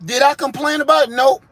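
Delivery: normal talking voice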